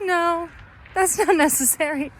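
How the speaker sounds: breezy dismissal. Retold or imitated. Officer waving off papers.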